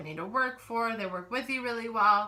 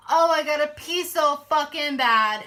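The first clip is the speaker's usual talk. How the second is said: loud, higher mock whine about needing to pee; mock whiny complaint; 7 semitones higher, 10 dB louder than usual. Mimicking pregnant coworker's loud complaint about peeing. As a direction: whine it loudly like she's dying to pee. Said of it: "she's like".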